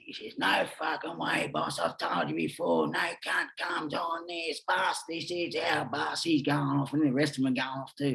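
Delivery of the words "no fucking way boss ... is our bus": put-on slow, drawn-out Scottish accent. Ex-prisoner imitating a biker refusing him the bus, drawn-out Scottish accent. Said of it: "yells out, he says, in that Scottish accent".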